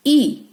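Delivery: ई said as short 'ih', as in rid; short phonics ई for letter I; short I sound. Shown as a demonstration. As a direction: give ई the brief vowel of 'pin'. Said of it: "आई का short sound है ई".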